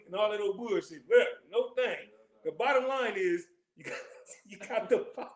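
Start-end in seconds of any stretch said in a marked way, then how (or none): 3.8-5.4 chuckling through the words; laughing. Real, not acted. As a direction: laugh while you say it.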